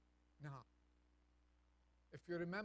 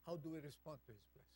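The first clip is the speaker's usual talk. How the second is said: ordinary talk, nothing marked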